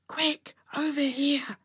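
urgent high stage whisper; muted yell. A narrator's reading. Kathy waving friends over to hide, muted yell. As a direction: yell it under your breath, slow and hushed. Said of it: "mouthed in a muted yell".

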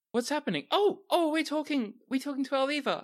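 high, chirpy excited character voice; chipper. Acted. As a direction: pipe up high, thrilled to hear from a friend. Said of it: "Their voice is very chipper".

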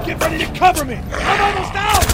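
shouted urgent call for cover. Acted. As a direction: yell it over gunfire. Firefight, calling for cover.

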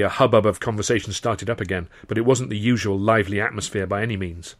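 ordinary delivery

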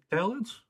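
"Italians" as a very high rising question; questioning delivery. Retold or imitated. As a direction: mimic "Italians?" with the pitch shooting right up. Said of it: "It's just the way it's like".

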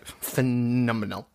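PHENOMENAL said slowly and low, sound by sound; a soft, deliberate second go at PHENOMENAL; careful pronunciation. Real, not acted. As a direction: take PHENOMENAL carefully, drawing it out. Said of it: "I think I said it right".

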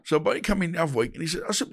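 ordinary talk, nothing marked

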